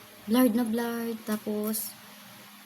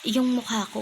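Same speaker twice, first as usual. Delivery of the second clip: usual speaking voice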